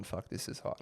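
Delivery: unvoiced whisper of a mate's line; a mate's line whispered, imitated; whispered quote. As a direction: breathe it out with no voice. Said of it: "And he's gone".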